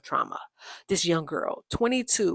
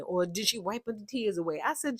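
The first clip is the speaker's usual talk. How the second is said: ordinary delivery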